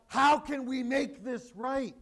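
loud slow pleading; crying out. Retold or imitated. The crowd pleading with Peter, slow loud cry. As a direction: beg loudly how to set it right. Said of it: "And they cried out".

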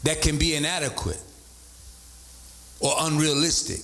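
slow dictation; slow restatement. Shown as a demonstration. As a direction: spell it out slowly, word by word. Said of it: "Let me say it again".

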